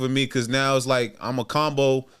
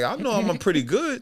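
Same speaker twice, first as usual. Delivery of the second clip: indignant self-defence; 9 semitones higher than usual. Retold or imitated. Retold protest at being cut.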